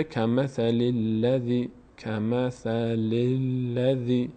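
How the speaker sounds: کمثل الذی slowly with a long final i; slow, measured Quran teaching voice; model recitation. Shown as a demonstration. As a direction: recite kamathalilladhi in drawn-out, even syllables.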